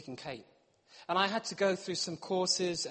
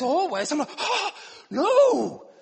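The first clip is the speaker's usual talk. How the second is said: mock outrage; 9 semitones higher, 8 dB louder, slower than usual. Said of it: "when she says".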